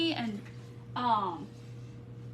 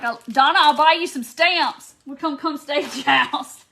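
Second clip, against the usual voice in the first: louder, eager mock-generous promise; eager offer; 3 semitones higher, 9 dB louder than usual. Donna offering stamps and a stay, loud and eager. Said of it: "Donna's like".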